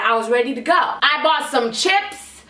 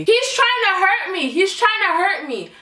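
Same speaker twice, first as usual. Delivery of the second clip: mock lady's claim; 7 semitones higher than usual. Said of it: "she knew how to say very, very clearly".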